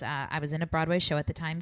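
ordinary talk

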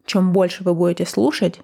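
ordinary talk

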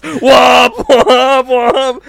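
high, loud, slow honking womps; imitated horn blaring. His own horn stuck in Best Buy, loud blaring honks.

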